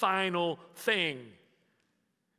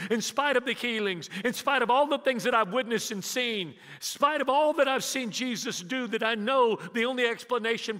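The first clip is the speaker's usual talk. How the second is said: fiery preaching; 5 semitones higher than usual. Acted. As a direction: drive each "in spite of" harder. The preacher builds in intensity.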